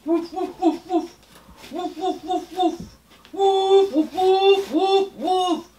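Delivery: playful high woofs; puppet dog barking. Acted. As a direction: bark happily like a cartoon puppy.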